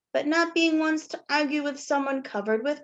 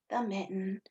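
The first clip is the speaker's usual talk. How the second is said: plain reading voice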